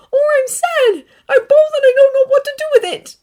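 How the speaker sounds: overwhelmed and crying out; tearful outburst. A narrator's reading. Izuku crying out his feelings to All Might.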